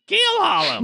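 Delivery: very high bird squawk. Acted. Game master voices the bird answering a sailor phrase, squawking. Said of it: "the bird says".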